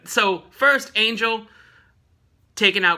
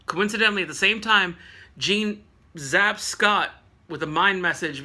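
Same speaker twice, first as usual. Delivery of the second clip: usual speaking voice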